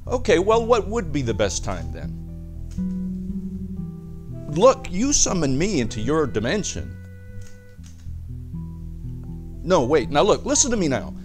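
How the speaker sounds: exasperated pleading. Acted. Doctor Torpor pressing a client for a reading, exasperated. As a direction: push the reluctant client, pitch jumping up.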